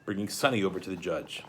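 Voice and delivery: ordinary delivery, nothing marked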